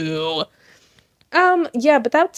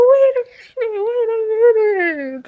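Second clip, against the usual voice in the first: high, cracking mock shriek; shocked disbelief; 11 semitones higher than usual. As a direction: squeal as if you can't believe it. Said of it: "I was like".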